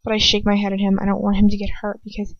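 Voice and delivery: ordinary delivery, nothing marked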